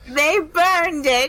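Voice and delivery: slow, high, teary mock sob; hammed-up weepy old lady; mock crying old lady. Retelling the old lady's answer to the Crooked Man, mock crying. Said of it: "And she's like".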